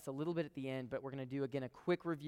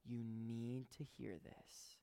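hushed appeal; 4 semitones lower, 12 dB quieter, slower than usual. Real, not acted. Sermon opening, hushed intimate appeal.

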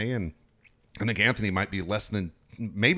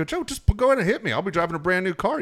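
taunting; 9 semitones higher, 4 dB louder than usual. Retold or imitated. Taunting manager to punch him.

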